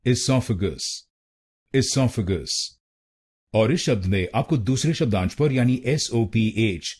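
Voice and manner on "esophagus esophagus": "esophagus" as i-SOF-a-gus, short i, stress on sof; correct pronunciation. Shown as a demonstration. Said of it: "तो बनेगा".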